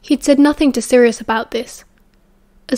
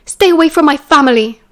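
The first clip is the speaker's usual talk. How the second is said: loud, higher angry warning; snapped; 4 semitones higher, 6 dB louder than usual. A narrator's reading. Marlene warns Remus off her family, snapping angrily. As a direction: snap it out, raised and falling. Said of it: "she snapped".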